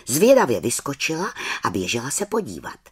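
usual speaking voice

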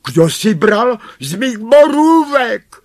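very high, loud outraged dwarf; high-pitched, loud dwarf cry; dwarf calling out. A narrator's reading. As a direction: call out the accusation in a high dwarf voice. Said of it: "zvolal šestý".

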